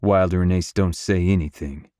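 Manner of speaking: ordinary delivery, nothing marked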